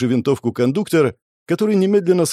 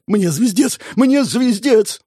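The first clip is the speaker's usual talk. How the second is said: much higher, second cry slower and stretched; panicked outcry; 11 semitones higher, 3 dB louder, slower than usual. A narrator's reading. Wanted man grabs a rifle, panicked outcry. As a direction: wail it as if all is lost.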